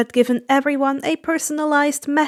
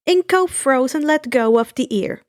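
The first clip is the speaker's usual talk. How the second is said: usual speaking voice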